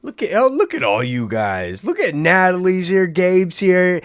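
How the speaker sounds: normal talking voice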